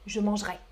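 "mangerai" clipped to two syllables, mange-rai; "mangerai" the quick Paris way; Parisian pronunciation. Shown as a demonstration. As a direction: drop the e in "mangerai": "manj-ré". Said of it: "Moi, je prononce".

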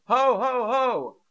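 high-pitched shouted ho-ho-ho; mock Santa shout. Shown as a demonstration. Teacher offering learners a guess at Santa's sound, mock shouting. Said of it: "Santa shouting?".

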